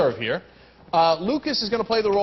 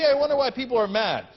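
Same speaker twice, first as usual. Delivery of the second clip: normal talking voice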